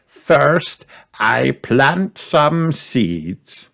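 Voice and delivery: put-on slow, high old grandpa. A narrator's reading. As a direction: explain slowly like a proud grandpa. Grandpa Pig voiced.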